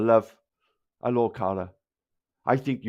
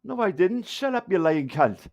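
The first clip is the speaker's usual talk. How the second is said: high-pitched indignant retort, mimicked; imitating Taffy; 7 semitones higher than usual. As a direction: act out his denial, voice jumping up. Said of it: "Taffy as he does then".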